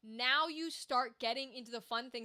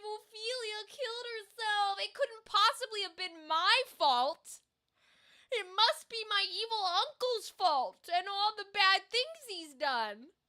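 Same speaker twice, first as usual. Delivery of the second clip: higher, mock-crying lament; hammed-up grief; 6 semitones higher, 4 dB louder than usual. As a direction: overact a sobbing, self-pitying prince. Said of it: "big emotional moment".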